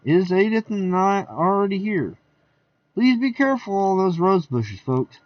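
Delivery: high, lively guest voices; party guests. Acted. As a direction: chatter like a rowdy party guest.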